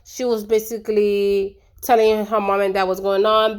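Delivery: usual speaking voice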